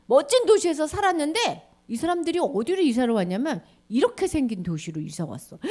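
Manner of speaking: usual speaking voice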